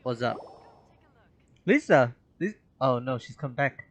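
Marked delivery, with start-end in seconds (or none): none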